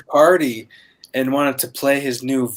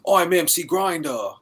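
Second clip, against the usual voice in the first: put-on English street accent; 2 semitones higher, 6 dB quieter than usual. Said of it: "Australian accents".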